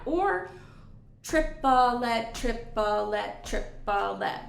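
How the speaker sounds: "triplet" split into three even syllables; counting triplets. Shown as a demonstration. Teacher showing other triplet syllables, evenly measured chant. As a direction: chant "trip-uh-let" slowly and evenly. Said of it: "Both are syllables that I hear".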